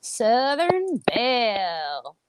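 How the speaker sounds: Southern Belle drawn out slowly in a Southern twang. Shown as a demonstration. Final take after the coaching, in a slow Southern twang. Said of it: "so use some twang".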